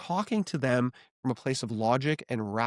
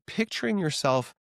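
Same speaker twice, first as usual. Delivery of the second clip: ordinary talk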